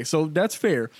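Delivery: ordinary talk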